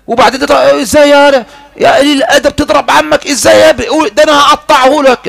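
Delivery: put-on high, loud scolding of a rude boy; angry scolding uncle. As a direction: bark it like an outraged uncle.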